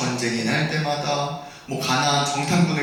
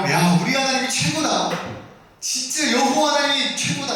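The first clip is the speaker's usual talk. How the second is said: acted crowd cheer, pitched up; praising; 7 semitones higher than usual. Israelites hailing God after the sea miracle, praising. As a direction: mimic a people exulting in their God. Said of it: "찬양을 합니다".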